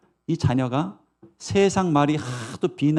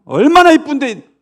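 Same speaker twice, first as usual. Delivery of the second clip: high, indignant outburst; acted motherly outrage; angry mother; 9 semitones higher, 9 dB louder than usual. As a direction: protest loudly on your child's behalf. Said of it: "이러면서 막 화를 내죠, 엄마가".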